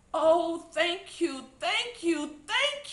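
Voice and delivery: cried with relief. A narrator's reading. Miss Maple crying thanks to the children. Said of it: "she cried".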